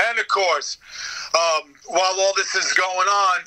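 ordinary delivery

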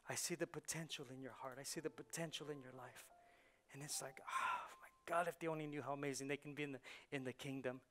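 soft, low, sighing wonder; hushed earnestness. Real, not acted. Preacher seeing the potential in his congregation, hushed earnestness. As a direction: drop to a hush, almost to yourself.

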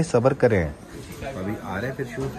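ordinary talk

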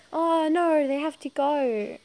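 loud, high retold wail; hammed-up dismay, drawn out; mock dismay. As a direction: act out an exaggerated letdown. Retold reaction on the phone.

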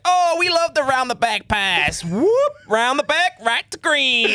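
high, slow, hyped-up commentator; Rob Paternostro. Retold or imitated. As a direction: ham up an excited play-by-play call. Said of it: "Watch Rob Paternostro on commentary".

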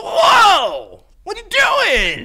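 loud, high, drawn-out alarmed cry; mock owner outrage. Mimicking friend catching his dog misbehaving, loud alarmed reaction. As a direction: imitate a shocked dog owner calling out. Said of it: "dog owner reaction".

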